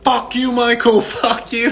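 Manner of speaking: high-pitched mock yell of a retold outburst; hammed-up angry cursing; mock angry shouting. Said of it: "like you're mad at somebody".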